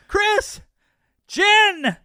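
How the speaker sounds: very high, loud name calls; shouting after them. A narrator's reading. Man shouting after children pedalling away, urgent high yell. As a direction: yell to stop someone riding away. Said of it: "I shout after them".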